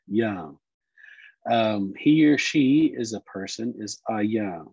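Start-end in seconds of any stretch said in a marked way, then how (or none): 0.1-0.5 Yah with a clipped uh vowel, final vowel dropped; Yah, final vowel dropped, ending on uh; Cherokee Yah. Shown as a demonstration. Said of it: "That V makes an uh sound".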